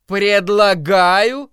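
slow, rising incredulous repeat; incredulous echo. Acted. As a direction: throw the word back in disbelief. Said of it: "эхом отозвалась миссис Кармоди".

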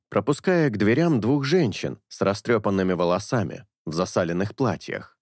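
ordinary delivery

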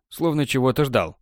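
ordinary delivery, nothing marked

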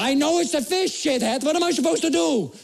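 high-pitched mock outrage; indignant acted demand for help; comic exasperated retort. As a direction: lose patience in pain, hammed up. Retold angry retort in pain.

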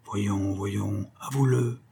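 soft, intimate coaxing; hushed, cajoling aside; close in the ear. A narrator's reading. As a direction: murmur it right by her ear. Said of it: "lèvres tout près de l'oreille".

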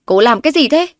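high, loud furious question; angry shout. A narrator's reading. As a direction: storm in shouting. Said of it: "một giọng tức giận khác vang lên".

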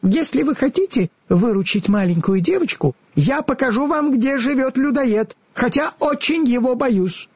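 high, squeaky little squirrel; small squeaky squirrel, a bit timid; squirrel character. A narrator's reading. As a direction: offer help in a tiny animal voice. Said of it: "сказала белка".

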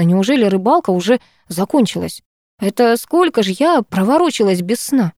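ordinary delivery, nothing marked

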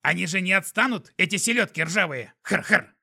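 high, loud snorting creature; grunting skunk laugh. A narrator's reading.

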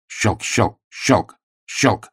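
ordinary delivery, nothing marked

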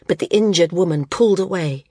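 plain reading voice

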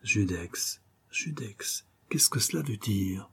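murmuring under the breath, then a whisper; softly murmured, the second word whispered. A narrator's reading. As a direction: mutter it to himself, almost a whisper. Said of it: "il se surprenait en train de murmurer".

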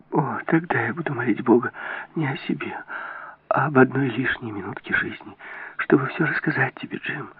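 high, soft, desperate entreaty; hushed imploring voice; pleading. A narrator's reading. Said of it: "молила она".

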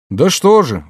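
a high, rueful sigh of words; sighed reply. Acted. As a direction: sigh it out, resigned. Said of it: "вздохнул Айске". (со вздохом)

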